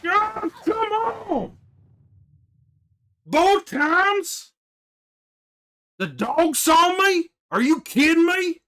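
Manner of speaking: loud, high angry yelling; shouting in outraged disbelief; furious disbelief. Real, not acted. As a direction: rage at getting spotted. Caught, furious at the game.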